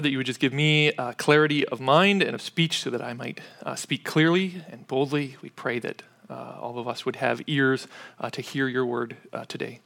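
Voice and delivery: soft, low, earnest prayer voice. Real, not acted. Pastor praying before the sermon, soft and low. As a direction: pray quietly before the congregation.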